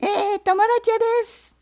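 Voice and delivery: loud, high hawker's shout. Acted. Fox shouts his call so the bear hears, loud and high. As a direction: raise your voice to be heard. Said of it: "狐が声を張り上げ".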